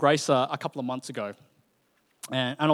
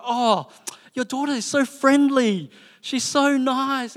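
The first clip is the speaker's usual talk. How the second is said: high, slow gushing praise; mock gushing stranger, much higher and slow; 9 semitones higher, 5 dB louder, slower than usual. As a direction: coo over the child like a charmed stranger. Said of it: "the people go".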